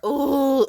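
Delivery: loud, high mocking ooh; a scandalized ooh, hammed up; mock snooty ooh.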